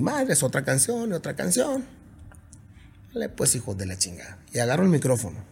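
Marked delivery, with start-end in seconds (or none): 3.1-4.4 lower, softer grumbled curse; muttered curse. Retold or imitated. Singer retelling his grumbling at the engineers. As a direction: growl it under your breath.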